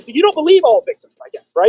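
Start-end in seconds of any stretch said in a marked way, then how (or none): none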